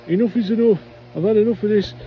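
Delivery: loud, high Geordie foreman at his limit; fed-up Geordie. Retold or imitated. Foreman Dougie tells off prankster Robert, loud Geordie accent. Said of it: "in his Geordie accent".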